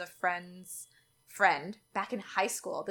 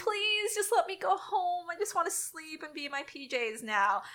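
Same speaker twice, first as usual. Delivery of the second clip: high whiny begging; beg, high and whiny; mock plea; 9 semitones higher than usual. Begging to go home.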